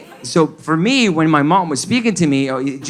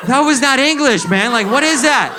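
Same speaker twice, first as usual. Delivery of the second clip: shocked outburst; 11 semitones higher than usual. Retold or imitated. Shocked reaction to aunt.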